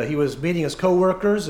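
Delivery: ordinary talk, nothing marked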